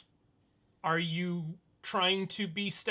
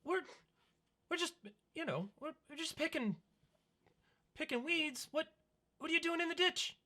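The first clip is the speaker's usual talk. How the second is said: put-on high child's voice, a bit softer; defensive child; 12 semitones higher, 5 dB quieter, faster than usual. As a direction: answer like a caught kid. GM voices child picking weeds.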